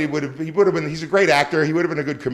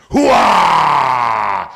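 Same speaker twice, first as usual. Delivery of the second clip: shouted Pacino hooah, louder; loud gung-ho soldier; 7 dB louder than usual. Acted. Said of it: "Here's his Al Pacino".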